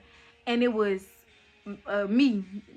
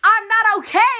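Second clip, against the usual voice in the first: much higher and louder, a distressed cry; upset protest; 11 semitones higher, 10 dB louder than usual. Retold or imitated. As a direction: push back hard against being told you're fine. Protesting while in pain.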